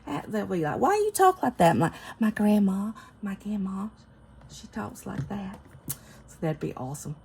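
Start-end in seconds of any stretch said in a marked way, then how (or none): 0.8-1.8 high, loud mock-puzzled question; puzzled questioner. Playmates questioning granddaughter's talk, mock puzzlement. 1.8-5.6 put-on Southern-accented granddaughter. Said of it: "Southern accent from her grandma".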